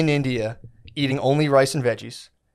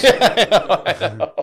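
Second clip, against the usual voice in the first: high-pitched laugh through the words; words breaking up in real laughter; 7 semitones higher, 5 dB louder, slower than usual. Real, not acted. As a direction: crack up while agreeing. Cracking up at the joke.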